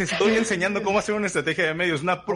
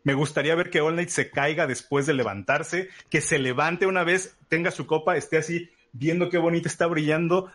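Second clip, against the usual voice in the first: usual speaking voice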